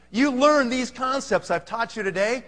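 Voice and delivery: ordinary delivery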